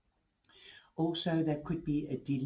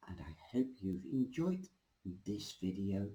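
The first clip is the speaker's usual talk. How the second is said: soft unvoiced whisper; 6 semitones lower, 6 dB quieter than usual. Real, not acted. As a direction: breathe the words out in a whisper. Sign-off before the reading.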